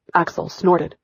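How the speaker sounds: usual speaking voice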